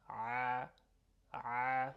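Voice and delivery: isolated ayn "a", drawn out; throaty ayn sound. Shown as a demonstration. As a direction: stretch a throaty Arabic "a". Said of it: "it's that same a, a sound".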